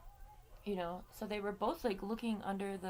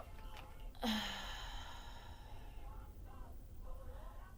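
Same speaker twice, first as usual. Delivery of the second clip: long, drawn-out breathy exhale; sighing out a long breath; long heavy sigh; 6 dB quieter than usual. Real, not acted. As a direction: let out a big, slow sigh before going on. Heavy sigh before sensitive story.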